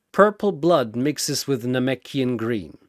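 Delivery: ordinary delivery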